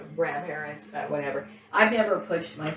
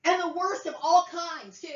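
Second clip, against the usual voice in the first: high-pitched anger; 9 semitones higher than usual. Real, not acted. Furious rant at relatives.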